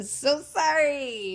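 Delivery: high, drawn-out, loud sing-song apology; mock sympathy. Host to the losing player, mock-sorry. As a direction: stretch out a hammed-up condolence.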